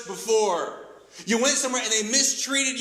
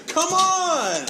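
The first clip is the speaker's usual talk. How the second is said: high, louder, exasperated come on; 9 semitones higher, 4 dB louder than usual. Real, not acted. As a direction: throw up your hands at them. Preacher exasperated at listeners.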